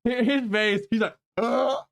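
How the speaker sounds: laughing, loud and high. Real, not acted. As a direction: laugh through the words. Laughing at a character's face.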